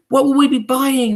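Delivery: high, loud eager question; witches crying out. Acted. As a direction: beg for the answer like hungry hags. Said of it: "they cried".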